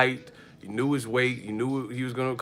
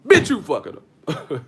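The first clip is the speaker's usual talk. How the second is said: mock loud insult; 3 semitones higher, 7 dB louder than usual. Neighbors arguing through hotel wall.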